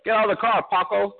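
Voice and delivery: mock cop order. Officer orders young David out of car, mock cop voice. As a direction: play the officer ordering him out.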